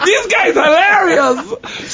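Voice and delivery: high, drawn-out squeal; slow high mock cry; delighted amazement. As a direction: imitate a crowd in hysterics. Gangsters' retold delight.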